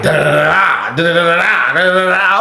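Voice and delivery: rising da da da chatter; imitated chatter. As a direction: mimic talk going on all night. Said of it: "That's what that shit was sounding like".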